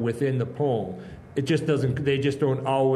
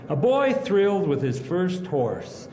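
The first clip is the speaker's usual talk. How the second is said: raised, ringing recital voice; poetry recitation; 5 semitones higher than usual. Acted. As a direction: recite the poem with feeling. Recites his poem.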